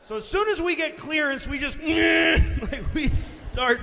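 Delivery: loud, frantic storytelling; excited shout. Real, not acted. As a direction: yell it like you're plunging. Terrifying plane descent retold.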